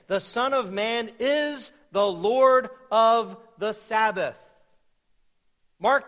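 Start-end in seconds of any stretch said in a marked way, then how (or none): 0.1-4.4 loud, slow, weighty declaration; emphatic preaching. Shown as a demonstration. Preacher restates the verse to the congregation, loud and emphatic. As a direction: drive each phrase home, slowly and forcefully.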